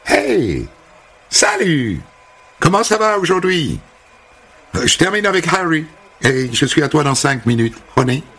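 high, gushing hairdresser greeting; sing-song high welcome; bubbly American hairdresser. Acted. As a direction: chirp it like an overfriendly American. Holly the barber greets him.

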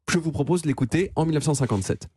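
normal talking voice

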